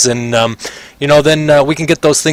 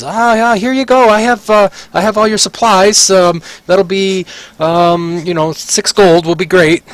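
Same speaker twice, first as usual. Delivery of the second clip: high, bright-eager clerk voice, raised well up; pitched-up cheerful clerk; eager shopkeeper; 7 semitones higher than usual. Acted. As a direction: chirp it like a helpful young shop boy. DM voicing shop clerk.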